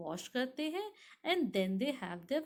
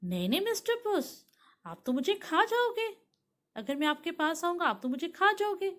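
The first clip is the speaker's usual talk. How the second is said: quick, high-pitched parrot protest; storybook parrot refusing the cat; 6 semitones higher, 4 dB louder, faster than usual. Acted. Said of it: "parrot बोला".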